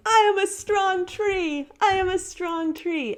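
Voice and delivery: put-on high-pitched kid's chant; imitating a child. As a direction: mimic a little girl riding her bike, calling out. Girl's video shouting affirmation.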